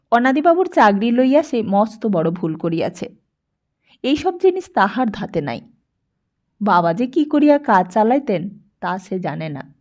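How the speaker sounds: usual speaking voice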